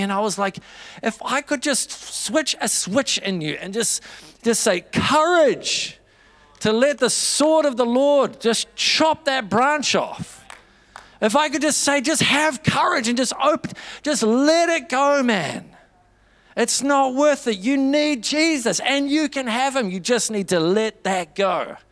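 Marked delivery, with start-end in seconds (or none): 1.0-15.7 higher, slow, urgent pleading; impassioned plea. Retold or imitated. Pleading with a struggling friend. 16.6-21.8 higher, slow, urgent pleading; impassioned plea. Retold or imitated. Pleading with a struggling friend.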